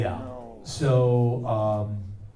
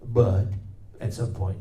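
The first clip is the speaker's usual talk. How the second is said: ordinary talk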